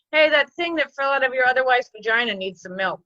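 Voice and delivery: normal talking voice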